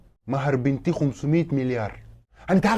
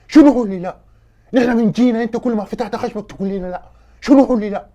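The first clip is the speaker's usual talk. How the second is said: loud, high angry protest; indignant yelling, punching the first word; outraged; 5 semitones higher, 7 dB louder than usual. Acted. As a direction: shout back indignantly at being refused. Guest outraged at repeated refusals.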